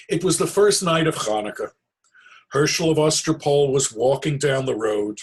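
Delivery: loud, lively storybook narration; storytelling narration. A narrator's reading. Storyteller opening the tale for children, lively read. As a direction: read it out big and bright for the kids.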